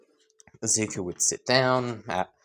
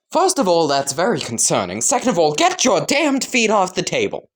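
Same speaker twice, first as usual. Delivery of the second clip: raised, emphatic telling-off; indignant scolding; 5 semitones higher than usual. Acted. Classmate orders feet off the table, indignant scolding. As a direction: scold them point by point, pitch jumping up.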